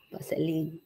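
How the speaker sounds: amused laughing repeat. Real, not acted.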